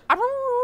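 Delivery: high, loud dog-like howl. Acted. Dog cheering the timer, loud howl. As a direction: let out a howl like a hound.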